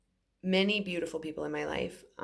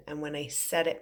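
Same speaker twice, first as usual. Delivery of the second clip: ordinary talk, nothing marked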